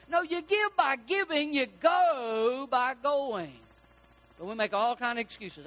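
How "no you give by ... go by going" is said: emphatic pulpit retort, final words drawn out; preaching. Real, not acted. Preacher corrects church's excuse of giving, preaching. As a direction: preach it, stretching "go" long and slow.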